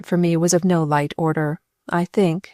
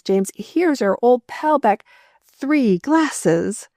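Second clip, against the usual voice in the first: higher, slow, delighted shouts to the butler; joyful shouting; 5 semitones higher, slower than usual. Acted. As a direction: call out to James, overjoyed to see his friend. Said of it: "as he shouted his instructions".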